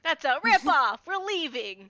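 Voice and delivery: loud, high protest; outraged shout. Acted. Player joins the shouted haggling over gold, shouting in outrage. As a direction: shout it like storming out of a shop. Acted outburst over a price.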